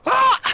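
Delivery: high, loud mock scream. The imagined scorched crewman, high loud mock scream. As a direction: shriek in cartoon terror. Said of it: "Just like".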